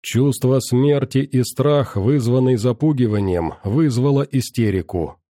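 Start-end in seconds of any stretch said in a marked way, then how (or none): none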